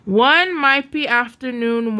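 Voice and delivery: usual speaking voice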